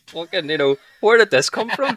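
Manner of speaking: retelling his own stunned reaction, loud and high; bewildered. Retold or imitated. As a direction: shout it out in disbelief. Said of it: "And I was like".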